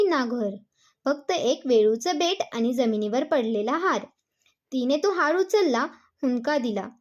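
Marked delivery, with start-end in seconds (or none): none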